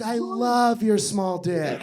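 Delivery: slow, drawn-out affectionate line; wife's sweet reply. Retold or imitated. Wife reassuring husband, slow drawn-out sweet tone. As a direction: stretch it out sweetly. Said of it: "She goes".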